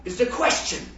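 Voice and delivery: grand, high, slow stage delivery of Hamlet; Shakespearean recital. Retold or imitated. Preacher mocks Shakespeare for the congregation with grand stage recital. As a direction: ham it up like an old stage actor. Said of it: "and go".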